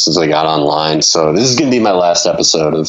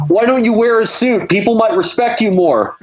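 very high-pitched, hammed-up cry of alarm; mock panicked scream. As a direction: wail it like someone waking from a nightmare, for laughs. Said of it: "wakes up in the middle of the night in a cold sweat screaming".

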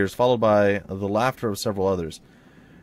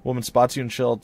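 ordinary talk, nothing marked

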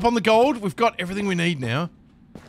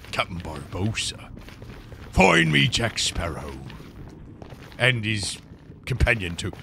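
lightly voiced pirate captain; 10 semitones lower, 4 dB quieter than usual.

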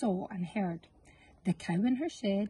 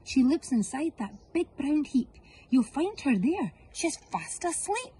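higher hen, sly; 6 semitones higher than usual. Acted. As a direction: coax the thieves toward the heap. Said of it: "chipped in the hen".

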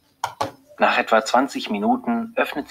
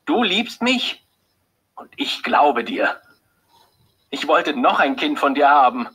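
much higher, bitter, louder accusation; full of hatred; 5 semitones higher, 3 dB louder than usual. A narrator's reading. Husband turning on his wife, hateful and loud. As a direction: spit each question at her. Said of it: "sagt ihr plötzlich voller Hass".

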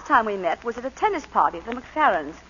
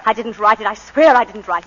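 desperate denial; 9 dB louder than usual. Acted. Accused, denying the letter.

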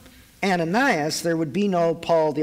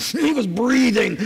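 hammed-up huffing, higher and louder; mock furious breathing; 6 semitones higher, 4 dB louder, slower than usual. Preacher acting out Saul's snorting rage, hammed-up. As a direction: puff it out like a snorting horse.

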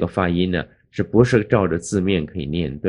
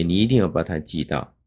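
ordinary delivery

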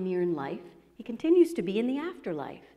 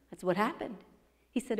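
ordinary delivery

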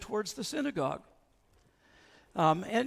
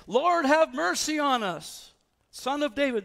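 loud pleading; 8 semitones higher, 7 dB louder than usual. Retold or imitated. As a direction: cry it out like a desperate blind beggar. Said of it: "two blind guys who are saying".

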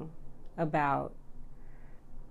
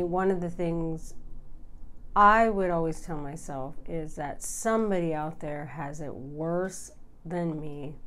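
normal talking voice

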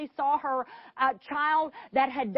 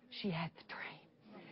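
breathy whisper, slow and low; 6 semitones lower, 17 dB quieter, slower than usual. Acted. Preacher revealing the answered prayer, whispered. Climax of sermon story.

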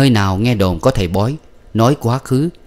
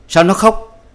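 sharp demand; 10 semitones higher, 4 dB louder, slower than usual. A narrator's reading. Adviser demanding why.

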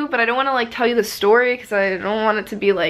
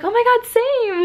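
high-pitched gushing imitation of a friend; excited agreement. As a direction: squeal it in mock-excited solidarity. Friend's excited reply acted out.

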